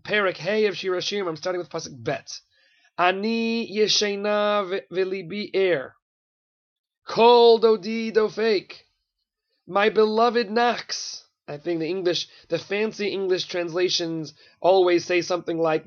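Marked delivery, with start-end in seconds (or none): 3.0-11.2 measured, drawn-out recitation of verse; slow reading. Shown as a demonstration. Teacher reading a verse of two lovers to students, slowly. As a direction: read it slowly and deliberately. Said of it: "I'm gonna read it slowly".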